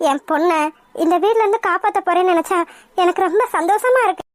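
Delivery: normal talking voice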